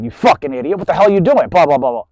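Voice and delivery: loud harsh shout; angry yelling. Shown as a demonstration. Scolding a dog the harsh way, loud angry yell. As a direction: bark the insult like you mean it. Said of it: "Or you can talk like this".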